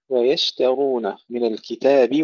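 slow, drawn-out chanted recitation; Quran recitation. Acted. Teacher recites the verse to students, slow tajweed chant.

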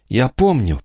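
plain reading voice

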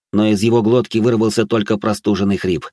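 ordinary delivery

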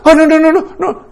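high, hurried mock pleading; panicked parent. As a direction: frantically placate a screaming child. Parent gives in to tantrum.

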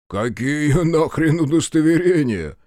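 high-pitched, jeering dismissal; mocking scoff. A narrator's reading. Fat policeman brushing off a demand for papers, mocking. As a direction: scoff from up high, chuckling at the upstart. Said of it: "хохотнул Мордатый".

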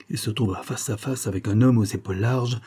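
ordinary delivery

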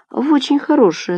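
high, lilting vague answer; evasive reply. A narrator's reading. Nadya dodging her mother's question, evasive. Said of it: "туманно отвечает Надя".

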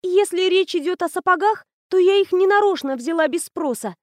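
put-on the girl explains to the principal innocently; innocent schoolgirl. A narrator's reading. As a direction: play the sweet innocent. Anya voiced.

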